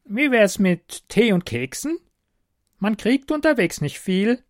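high, loud goblin begging for tea; hint for treats like a cheeky goblin. A narrator's reading.

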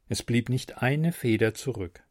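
usual speaking voice

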